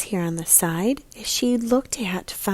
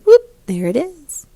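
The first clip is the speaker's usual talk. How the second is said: bright, louder burst of discovery; excited discovery; 8 semitones higher, 11 dB louder than usual. Real, not acted. Teacher showing children the boot in the picture book, excited. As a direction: pop the line like finding a treasure.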